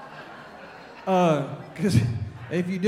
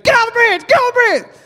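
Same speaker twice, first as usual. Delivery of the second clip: much higher, louder screaming plea; panicked girls; 7 dB louder, faster than usual. Retold or imitated. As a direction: shriek it like terrified girls in a car. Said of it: "the girls in the back seat start freaking out".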